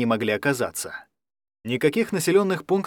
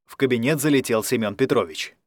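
ordinary delivery

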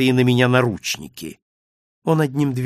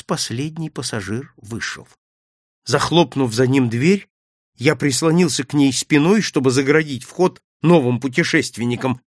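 ordinary delivery

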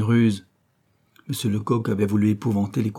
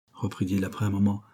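usual speaking voice

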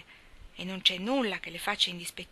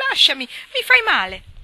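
high, loud tearful plea; much higher and louder, breaking into tears; crying out; 8 semitones higher, 15 dB louder than usual. Acted. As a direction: cry it out, begging him to let go. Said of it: "si mise a piangere".

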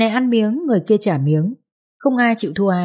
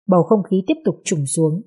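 ordinary delivery

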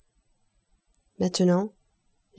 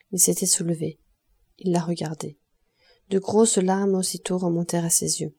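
ordinary delivery, nothing marked

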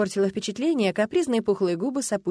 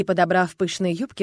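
ordinary delivery, nothing marked

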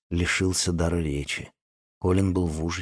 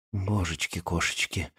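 softer stunned murmur; quiet; 3 semitones lower, 9 dB quieter than usual. Acted. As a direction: say it softly in shock. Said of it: "тихо сказал Колин". (тихо, ошеломлённо)

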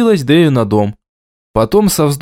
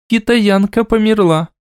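gloomy statement; 8 semitones higher than usual. A narrator's reading. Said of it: "хмуро констатировала Аймара".

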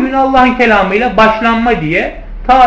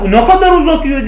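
mock complaint; 7 semitones higher, 3 dB louder than usual. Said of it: "der ya".

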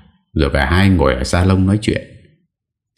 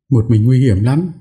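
worried wife; 8 semitones higher than usual. Acted.

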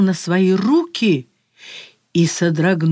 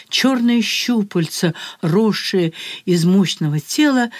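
ordinary delivery

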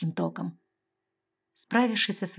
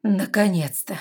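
grumbling; 5 dB louder, slower than usual. A narrator's reading. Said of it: "буркнула Лестра".

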